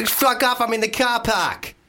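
put-on high-pitched, brash Boston accent; put-on Boston accent, pitched high and drawn out. Podcaster proving he can do Boston, brash accent. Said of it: "That's Boston".

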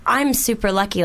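ordinary talk, nothing marked

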